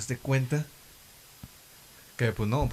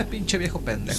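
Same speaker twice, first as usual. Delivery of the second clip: put-on higher, sneering little girl; mocking girl voice; 7 semitones higher than usual. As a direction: taunt him like a bratty kid. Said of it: "así medio burlona".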